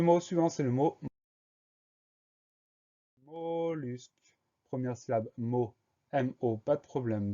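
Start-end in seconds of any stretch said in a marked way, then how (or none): 3.2-4.1 "mollusque" stretched, higher and softer; soft, held "mollusque" for a spelling lesson; dictation word. Shown as a demonstration. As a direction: draw out the first syllable of "mollusque". Teacher announces word for spelling.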